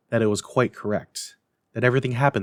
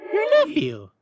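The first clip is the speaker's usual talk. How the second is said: very high, otherworldly spirit; ghost voice; 4 dB louder than usual. A narrator's reading. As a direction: float it like an eerie spirit. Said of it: "said the ghost".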